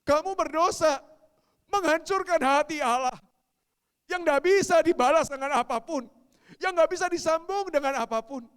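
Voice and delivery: loud, high thundering rebuke; forceful pulpit shouting; preaching at full force. Acted. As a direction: drive each phrase home loudly.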